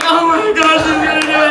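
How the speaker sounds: loud imitation of alarmed friends; aghast friends. Friends watching her stand up for the act, aghast. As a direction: call it out, mimicking a scandalized group.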